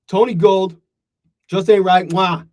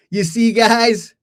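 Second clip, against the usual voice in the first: gloating; 8 semitones higher, 3 dB louder, much slower than usual. Real, not acted. Gloating to chat.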